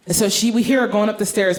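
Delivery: ordinary talk, nothing marked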